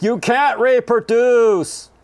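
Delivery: high, loud, slow mock yell; hammed-up guard shouting the rule; security guard yelling. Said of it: "the security guard's like".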